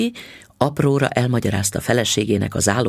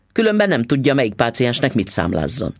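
plain reading voice

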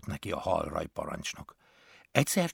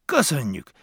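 bright eager thank-you, a bit higher; a mildly raised, eager thank-you; enthusiastic thanks; 5 semitones higher, 6 dB louder than usual. A narrator's reading. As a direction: gush thanks happily. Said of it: "lelkendezett Pizsi".

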